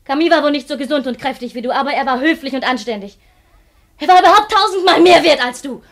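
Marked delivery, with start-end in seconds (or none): 4.0-5.8 loud, higher, furious shout; a wife yelling the truth at her husband. Acted. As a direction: scream it in his face. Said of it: "nun schreie ich sie dir ins Gesicht".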